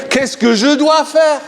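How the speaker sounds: high, anxious retold shout; a frantic plea, half-shouted; panicked cry. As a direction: cry out in alarm at the crisis. Panicked thought in retold crisis.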